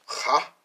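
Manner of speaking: "cha" with a throaty rasped H; teacher demonstrating a raspy "cha"; rasping H. Shown as a demonstration. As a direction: sound out "cha" loudly, rasping the start. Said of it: "like a rasping H".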